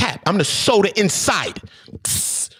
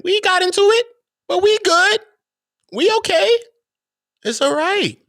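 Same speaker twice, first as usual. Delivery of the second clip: high mock voice; 13 semitones higher than usual. Mocking high exaggeration.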